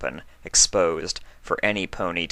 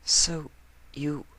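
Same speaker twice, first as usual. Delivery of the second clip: much higher, whispered disbelief; hushed hopeful mare, much higher; breathless hope; 8 semitones higher, 6 dB quieter than usual. Acted. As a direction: whisper it, hardly daring to hope. Astonished Octavia asks softly.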